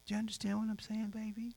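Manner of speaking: very soft, high, quick question; soothing fatherly softness; tender check-in. Retold or imitated. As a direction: drop to a gentle coaxing hush. Softens after scolding her.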